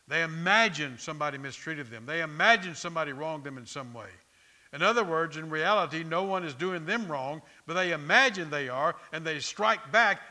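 loud, driving preacher's build; loud pulpit build; preaching at full force. Acted. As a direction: hammer it home to the congregation.

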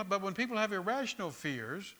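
ordinary talk

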